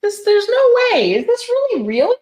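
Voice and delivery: high, loud astonished; shocked disbelief. Real, not acted. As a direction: blurt it out in total disbelief. Shocked at the product.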